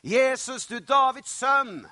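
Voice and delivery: loud, high cry to someone far off; calling out. Acted. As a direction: call out for help across a crowd. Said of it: "så roper han".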